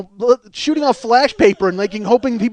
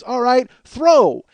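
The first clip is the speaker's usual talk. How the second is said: raised, drawn-out command; calling the cue; much slower than usual. Retold or imitated. Magician cueing a blindfolded man to throw, raised command.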